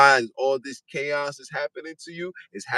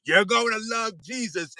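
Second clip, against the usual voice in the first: mock missionary; 7 semitones higher than usual. Quoting colonizers preaching Jesus.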